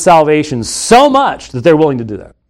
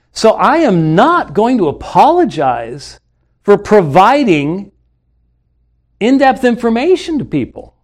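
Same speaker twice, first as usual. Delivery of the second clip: defiant; 6 semitones higher than usual. Real, not acted. Defiant refusal to apologize.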